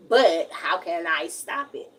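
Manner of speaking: usual speaking voice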